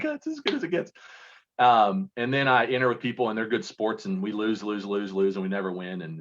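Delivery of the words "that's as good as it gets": much higher, hammed-up mocking voice; high mocking parody of a bored partner; mock squeaky quote. Mocking partner's indifferent response.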